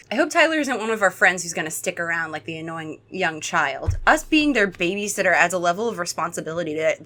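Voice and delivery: ordinary talk, nothing marked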